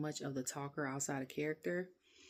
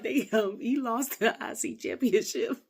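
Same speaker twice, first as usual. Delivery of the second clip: laughing; 6 semitones higher, 9 dB louder, slower than usual. Real, not acted. Laughing at the joke.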